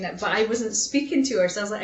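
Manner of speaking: ordinary delivery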